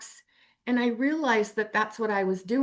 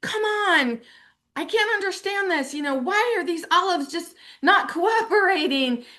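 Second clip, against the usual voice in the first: exasperated; 7 semitones higher than usual. Retold or imitated. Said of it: "and I was like".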